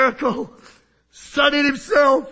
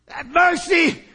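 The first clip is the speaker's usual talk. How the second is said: loud plea; 6 semitones higher, 5 dB louder, slower than usual. Acted. Beggar cries to Jesus.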